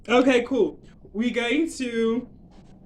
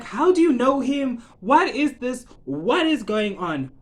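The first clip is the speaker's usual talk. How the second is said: loud, raised-pitch demand for answers; acted bewilderment; 5 semitones higher, 6 dB louder, slower than usual. Everyone questioning how father knows him, acted outrage. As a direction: imitate a baffled, outraged crowd. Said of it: "everyone is like".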